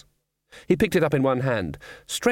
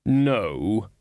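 slow, measured voice of Death; lightly voiced Death. A narrator's reading. Death thanking someone before he rides, in a slow measured voice. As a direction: voice Death with drawn-out words.

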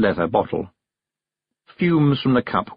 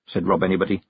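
plain reading voice

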